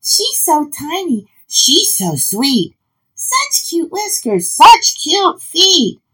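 put-on high-pitched, gushing children; happy cooing. A narrator's reading. Children gushing over their tiny new pet, happy cooing. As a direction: coo over something adorable, high and drawn out. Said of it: "they cried out happily".

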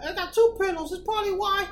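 high-pitched self-talk; mock realization. Sleepless man working out the pillow problem, high mock realization. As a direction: act out a sudden theory in a high voice.

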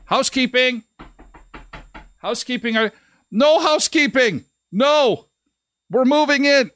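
high called-out "housekeeping"; calling through the door. Retold or imitated. To housekeeping knocking, a mock shouted refusal. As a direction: yell back through the bathroom door to turn them away.

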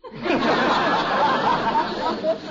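loud, high rolling chuckle; hearty chuckle. Acted. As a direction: let a big chuckle roll out. Gildersleeve laughs at Leroy's joke.

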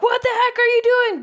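high, loud incredulous outcry; mock alarmed yell. Imitating movie bystanders at the hero's stupid move, mock alarm. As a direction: cry out like an appalled onlooker, hammed-up.

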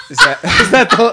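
high, quick words through laughter; laughing. Real, not acted. Co-host cracks up at the short impression, laughing. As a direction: crack up while talking.

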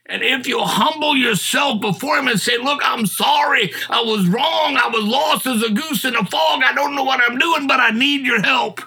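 high-pitched, urgent gospel appeal; impassioned preaching. Real, not acted. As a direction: preach it straight at the lost listener.

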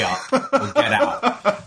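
high, slow, laughing command; laughing ultimatum. Retold or imitated. Bartender throwing out the customer, laughing. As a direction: spell it out slowly with a laugh.

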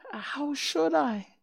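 put-on high, slow girlish answer; little girl's voice. A narrator's reading. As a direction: answer innocently as a startled girl. Dorothy answers the melting witch.

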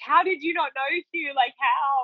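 high, incredulous question; exasperated how. Retold or imitated. Wife scolding husband Hugh, high and incredulous. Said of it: "but I'm like".

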